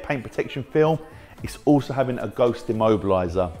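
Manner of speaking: ordinary talk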